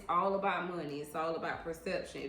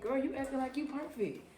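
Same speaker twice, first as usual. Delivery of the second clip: higher, retold sassy comeback with attitude; 7 semitones higher than usual. Retelling to her viewers how she checked a girl, sassy and high. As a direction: throw the insult back with sass. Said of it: "I was like".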